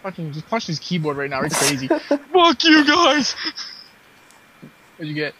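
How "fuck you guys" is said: high, loud, drawn-out rage parody; mock angry yell. Imitating the pissed player punching his keyboard, mock yell. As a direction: yell it as the furious loser.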